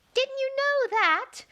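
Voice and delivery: teasing piping flower voice; another daisy crying. Acted. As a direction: squeak it like a cheeky little flower. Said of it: "cried another daisy".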